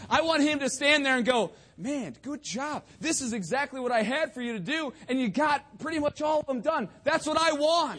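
loud, high-pitched pulpit exhortation; rally the congregation loudly; forceful preaching. Real, not acted.

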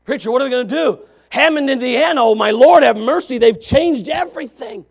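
loud, high-pitched fretting church member; hammed-up panicked congregant; mock panic. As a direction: wail to the preacher in exaggerated alarm. Voices a panicking church member to preacher.

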